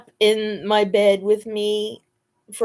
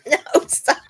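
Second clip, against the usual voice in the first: giggling no, stop; laughing protest; 6 semitones higher, 4 dB louder than usual. Real, not acted. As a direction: laugh it out at the dog. Dog jumping, laughing.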